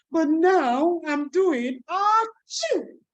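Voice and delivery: high, drawn-out sneezy voice; sick duck. Shown as a demonstration. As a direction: act out catching a cold. Acting a sneeze for kids.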